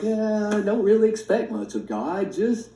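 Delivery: sarcastic imitation of weak faith; mocking a doubter. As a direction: parody people who expect nothing. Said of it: "That's who now we're like".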